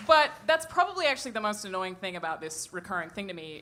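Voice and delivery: ordinary talk, nothing marked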